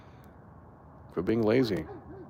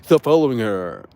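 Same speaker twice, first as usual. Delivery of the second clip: mock-preachy, louder; hammed-up scold of a do-gooder; 2 semitones higher, 7 dB louder than usual. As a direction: parrot the self-righteous stranger.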